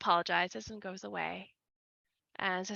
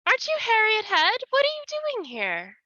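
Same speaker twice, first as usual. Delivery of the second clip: put-on much higher, louder surprised young woman; startled recognition, pitched way up; young woman's voice; 14 semitones higher, 9 dB louder than usual. Young woman surprised to see the nun, much higher. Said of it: "And the woman says".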